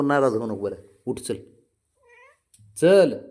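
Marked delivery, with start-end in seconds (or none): 2.8-3.2 loud coaxing call. Real, not acted. Coaxing pet to get up.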